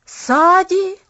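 high, loud, startled cry of a name; sudden loud, incredulous question; sharp exclamation. A narrator's reading. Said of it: "резко воскликнул Джимми".

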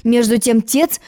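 usual speaking voice

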